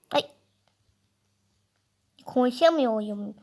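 ordinary delivery

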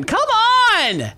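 high, loud, drawn-out yell; frustrated shout. Real, not acted. Player stuck on the worst quest, frustrated shout. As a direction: shout it at full exasperation.

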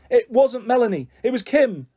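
put-on upset child's cry, pitched right up; sobbing. A narrator's reading. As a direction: blurt it out as if sobbing. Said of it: "I sobbed".

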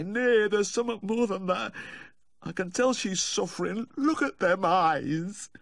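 high tearful insistence; plaintive high wail; sniffing and moaning. A narrator's reading. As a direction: insist woefully, dragging out the last words. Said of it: "Humphrey, sniffing and moaning".

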